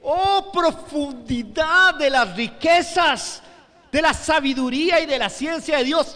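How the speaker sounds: high, loud, slowly stretched cry of wonder; contemplative exclamation. Retold or imitated. Preacher showing how Pablo exclaimed it, contemplative wonder. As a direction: marvel aloud, stretching every phrase.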